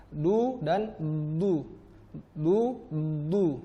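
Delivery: light "du" for dal, then heavy emphatic "du" for dot; dal versus dot. Shown as a demonstration. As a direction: alternate a thin and a thick "du". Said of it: "dibaca huruf dalnya du".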